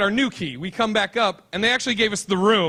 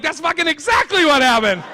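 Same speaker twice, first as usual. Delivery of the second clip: mock yell; 7 semitones higher, 5 dB louder than usual. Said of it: "I'm on the wall like".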